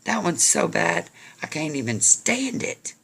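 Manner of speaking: high-pitched fed-up protest; acted disgust. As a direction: exclaim it in mock disgust. Apple quarrel reaches its peak.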